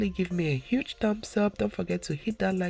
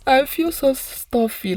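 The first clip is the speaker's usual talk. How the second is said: louder, higher mock-whiny complaint; stuffy; 7 semitones higher, 8 dB louder than usual. Real, not acted. Vlogger whining to viewers about her blocked nose, loud and high. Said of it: "I feel so stuffed up".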